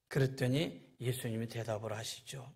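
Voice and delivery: usual speaking voice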